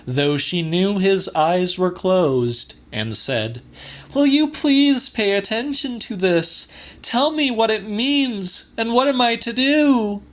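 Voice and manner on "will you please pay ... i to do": put-on much higher, urgent pleading young woman; a desperate young woman begging her guardian. A narrator's reading. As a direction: beg him to listen, hands clasped. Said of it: "raised her clasped hands".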